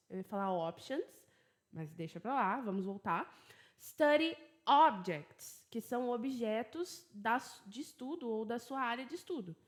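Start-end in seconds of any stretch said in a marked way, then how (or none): 3.8-5.6 Study objects, slow, higher and louder; teacher drilling the phrase; slow emphatic model. Shown as a demonstration. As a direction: stress Study, say it slowly and clearly. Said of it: "repitam comigo".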